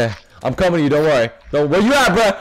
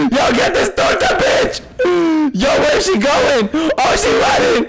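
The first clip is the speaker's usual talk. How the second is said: high, frantic gamer shouting; real high-pitched excited shouts; excited yelling; 6 semitones higher than usual. Real, not acted. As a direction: yell through a wild chase. Yelling while chasing opponent.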